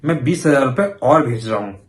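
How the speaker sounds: ordinary delivery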